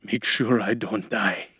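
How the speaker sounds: slow, weakened last request; slow, lumbering voice. A narrator's reading. Man fainting asks helper for care, slow and lumbering. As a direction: drag the words out, about to pass out. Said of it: "he said in a slow, lumbering voice".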